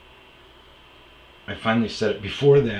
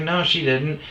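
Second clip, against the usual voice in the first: indignant denial; 10 semitones higher, 8 dB louder than usual. Retold or imitated. Ex denying.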